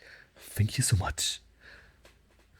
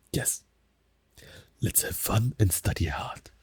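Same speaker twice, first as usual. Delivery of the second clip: whisper. Acted. Teacher whispering a welcome to learners. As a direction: breathe the words close to the mic.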